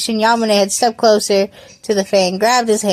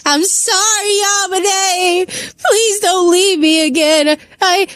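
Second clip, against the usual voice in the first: high, drawn-out sobbing act; hammed-up crying voice, stumbling over words; wailing plea; 9 semitones higher than usual. A narrator's reading. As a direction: beg tearfully, pitched way up. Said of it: "Aito wailed as he fell".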